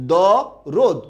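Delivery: long দ, a gap, then রোদ with closed o; syllable-by-syllable pronunciation. Shown as a demonstration. Teacher repeating the pronunciation for students, syllable by syllable. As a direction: say দ রোদ in two separated beats. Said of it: "উচ্চারণটা কি?".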